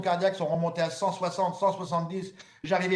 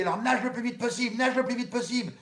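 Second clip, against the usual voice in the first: urgent command; 6 semitones higher than usual. Retold or imitated. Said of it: "Elle me dit".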